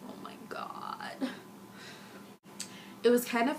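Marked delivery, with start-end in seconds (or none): 0.1-1.3 breathless whispered awe; hushed gasp of delight. Real, not acted. Gushing over the song.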